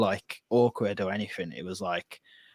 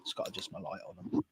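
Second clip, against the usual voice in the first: whispered aside; 13 dB quieter, faster than usual. Real, not acted. Aside while fixing light.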